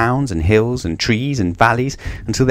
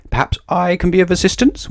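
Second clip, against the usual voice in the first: higher, oily crocodile voice; oily reptile offering help; oily wheeze; 9 semitones higher than usual. A narrator's reading. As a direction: sound slyly helpful, wheezing. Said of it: "wheezed a particularly oily voice".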